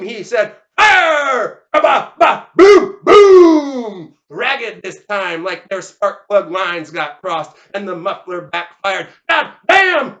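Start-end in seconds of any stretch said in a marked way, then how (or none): 0.8-1.6 high, long-held bellow; shouted firing command. Acted. Wayburn to the gunmen, bellowed order. 1.7-4.1 ragged stutter into huge booms; imitated gun volley. Narrator sounding ragged shotguns, loud imitated blasts. 9.3-10.2 high, loud outburst; yelled curse. Acted. Leonard after the blast, yelled curse. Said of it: "Leonard Askins yelled".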